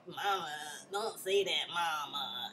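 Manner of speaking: soft, low, feeble objection to mama; mock half-hearted protest. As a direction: imitate a meek, toothless scolding. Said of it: "you ain't even really, like, checking her".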